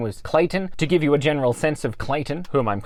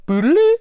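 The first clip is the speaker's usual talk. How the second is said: high, louder imitated machine "boodaloo" beep; 14 semitones higher, 6 dB louder than usual. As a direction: mimic the diagnostic tool's looping beep. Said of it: "boodaloo sound".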